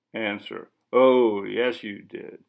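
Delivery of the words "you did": plain reading voice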